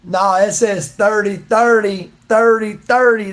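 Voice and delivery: loud and slow, every "thirty" pronounced clearly with a "th"; slow, stressed thirty. Shown as a demonstration. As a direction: press each "thirty", loud and drawn out. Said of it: "you can say dirty, dirty if you want".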